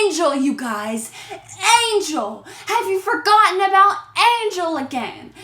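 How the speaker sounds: high, loud calling out at the screen; exasperated shouting. Real, not acted. Vlogger yelling at the screen, exasperated. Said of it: "I'm just like".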